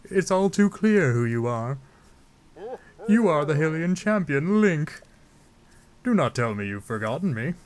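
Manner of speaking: theatrical king, stately and curious; King Dorephan. Acted. Zora king recognises the champion Link, grand put-on voice. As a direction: declaim it as a grand old-world ruler. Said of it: "I don't know why I'm giving him that voice".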